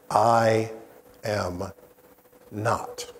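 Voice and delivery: slow, word by word, I AM NOT; emphatic denial. Retold or imitated. Peter's three-word denial, spoken slow and emphatic. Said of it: "Peter emphatically states".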